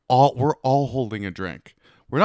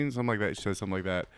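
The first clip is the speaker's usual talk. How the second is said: usual speaking voice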